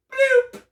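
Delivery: high, loud bloop; sudden power-down blip, imitated high and loud; kid shutting off. Kid goes out after old man's code, imitated bloop. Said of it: "the kid just goes".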